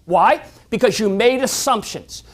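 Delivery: usual speaking voice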